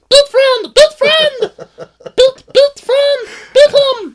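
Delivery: very high, loud squeaky puppet; goofy muffled sock puppet. Pretend. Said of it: "puppet voice".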